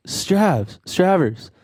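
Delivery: high, dopey call of a nickname; lightly voiced stoned bandmate; imitating Andy. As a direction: call out the name like a stoned friend. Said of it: "And he goes".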